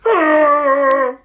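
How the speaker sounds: high, loud mock scream; mom's freak-out scream. Mom freaking out for the kids, high mock scream. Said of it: "his mom freaks out like this".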